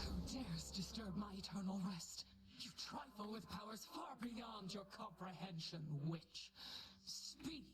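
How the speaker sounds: lightly voiced spooky ghost king; hint of an eerie undead ruler; ancient rasping undead king. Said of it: "you were pretty spooky beforehand".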